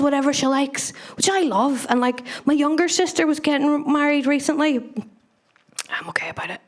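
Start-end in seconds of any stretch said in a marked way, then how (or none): 5.9-6.6 a breathy, voiceless aside to the crowd; whispered aside. Real, not acted. Comic aside to the audience about her sister's wedding, whispered. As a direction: hush it like a secret nobody should hear.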